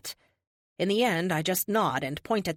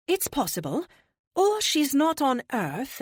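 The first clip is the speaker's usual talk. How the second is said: serene higher oracle, gently amused; 8 semitones higher than usual. Acted. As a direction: speak like a calm ancient seer. Oracle character voice.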